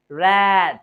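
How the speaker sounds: "रैट" loud, with a wide, drawn-out ऐ; accented ऐ vowel. Shown as a demonstration. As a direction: open the mouth wide on "रैट". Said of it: "मेरे मुंह को देखिएगा".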